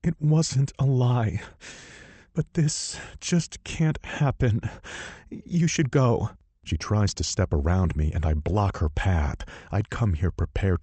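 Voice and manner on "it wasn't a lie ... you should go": higher, slow, held-back tears; tearful heroine. Acted. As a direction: fight the tears and send him off.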